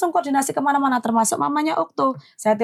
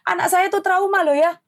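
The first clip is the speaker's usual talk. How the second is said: higher, indignant retort; retold snapping; 7 semitones higher than usual. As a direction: throw it at him like an accusation. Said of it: "langsung saya nyolot". (protes ibu yang marah dengan nada tinggi)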